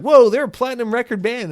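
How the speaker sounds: high, loud indignant gasp; mock outrage. Sitcom friends defending the band, mock indignant. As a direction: defend the band like it's sacred. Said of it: "everyone's like".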